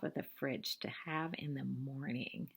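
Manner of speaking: secretive hushed whisper. Acted. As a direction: whisper it like a hidden treat. Hiding lollipop for the morning.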